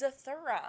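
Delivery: "Zathura" with an "ur" middle vowel, like "thur"; correcting pronunciation. Shown as a demonstration. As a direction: say "Zathura" as za-THUR-a, not za-THOR-a. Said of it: "why are you saying it weird?".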